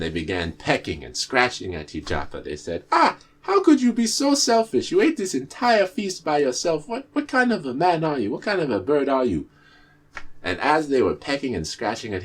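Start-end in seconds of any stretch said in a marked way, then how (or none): 2.9-9.5 high, loud outraged cry at the glutton; angry birds. Acted. Birds scolding the greedy tortoise, high and outraged. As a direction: scold him in high indignant shrieks. Birds angrily confront selfish Ijapa.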